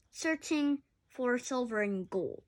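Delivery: ordinary delivery, nothing marked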